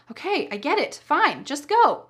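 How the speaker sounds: curt grudging consent, pitch jumping up; annoyed. Shown as a demonstration. Wife really doesn't want him to go, annoyed. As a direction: say fine while meaning don't, slowing on "just go". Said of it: "your true feelings are".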